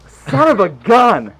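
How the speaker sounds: slow, loud, drawn-out exasperation; mock frustration. Narrator cursing after being blue balled, mock frustration. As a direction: stretch out the hammed-up curse.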